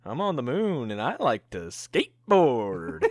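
ordinary delivery

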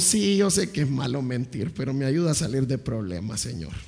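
soft, sheepish mock excuse to God, fading away. Preacher imitating a believer excusing a lie, soft and sheepish. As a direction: mumble the lame excuse under your breath.

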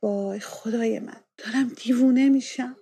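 a mother spiraling in worry, voice rising; distressed. Acted. Inner panic over the pregnancy. (درمانده و پریشان، با صدای بالا)

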